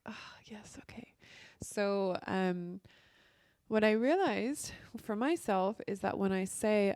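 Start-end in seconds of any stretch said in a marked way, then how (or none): 0.0-1.1 whispered, caught-out concession; sheepish whisper. Retold or imitated. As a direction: whisper it like you've been caught.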